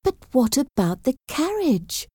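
high, impatient question. A narrator's reading. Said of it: "asked Jane impatiently".

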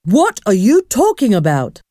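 put-on exclaiming voice, moderate volume; mock shout in a storybook voice; indignant shout. A narrator's reading. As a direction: demand it in baffled outrage. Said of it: "shouted Eric the Red".

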